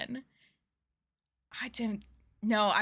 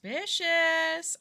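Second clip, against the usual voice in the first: high, slow, loud drawn-out suspicion; suspicious impression; 8 semitones higher, 5 dB louder, much slower than usual. Mock sleuth reacting to the detective game, high and drawn out. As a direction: stretch it out like a sleuth. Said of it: "I can only do impressions".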